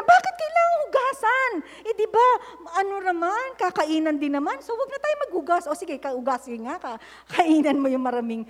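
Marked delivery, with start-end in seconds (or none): 0.0-5.7 very high mock child voice; whiny child excuse. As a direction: whine out the excuse. (ginagayang batang nagrereklamo)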